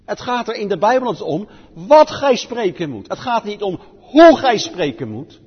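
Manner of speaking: loud, stressed wat then hoe; preacher pounding a point home; emphatic contrast. Real, not acted. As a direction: hammer the contrast between the two words.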